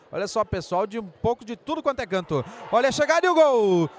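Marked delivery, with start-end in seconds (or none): 2.7-3.9 stretched-out goal shout; goal call. Real, not acted.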